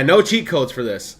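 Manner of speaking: ordinary delivery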